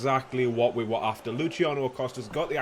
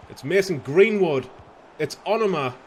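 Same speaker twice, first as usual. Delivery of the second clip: excited; 5 semitones higher, 7 dB louder than usual. Real, not acted. Building attack commentary.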